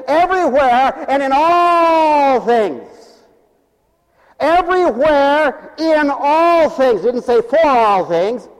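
loud, slow, drawn-out preaching; full-force preaching. Acted. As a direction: preach it big to the congregation.

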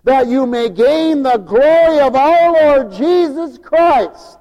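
loud, slow, ringing preacher's cadence; preaching. Acted. Preacher proclaiming to the congregation, full force.

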